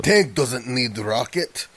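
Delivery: slow, heavy Russian-accented impression; hammed-up broken-English Russian scientist; thick Russian accent. Podcaster imitating Granin for his co-hosts, thick Russian accent. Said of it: "He doesn't talk like that".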